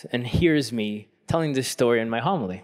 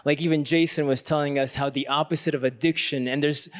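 usual speaking voice

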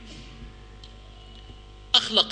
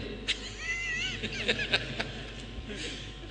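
high, breathy burst of laughter; 9 dB quieter than usual. Real, not acted. Laughing at own analogy. (ضحكة عالية)

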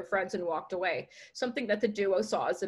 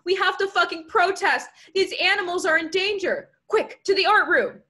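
high, loud rallying cry; alarmed activist shouting orders; urgent yell; 9 semitones higher, 7 dB louder than usual. A narrator's reading. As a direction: rouse your friend to action. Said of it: "yelled Sam".

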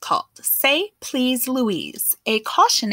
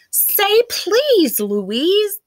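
high, loud pleading chorus; coaxing plea to a rude girl; 10 semitones higher, 5 dB louder, much slower than usual. A narrator's reading. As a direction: beg her, voice up high and loud. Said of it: "the others pleaded".

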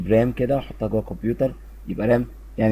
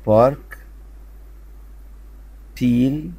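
each word called out loud and clear; loud model word; 3 semitones higher, much slower than usual. Shown as a demonstration. Teacher reads the column aloud to pupils, loud. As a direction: sing out the word for the class. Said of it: "نقرا مع بعض العمود دوت".

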